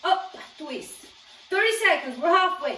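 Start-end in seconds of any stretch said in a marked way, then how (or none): 1.5-2.8 loud, high halfway call; coaching shout. Real, not acted. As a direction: pump the class up. Coach calling out mid-workout.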